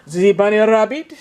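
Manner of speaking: "The Bunny Rabbit" in a playful French accent, "zi bunny rabbit". Shown as a demonstration. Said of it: "I like to say that with a French accent".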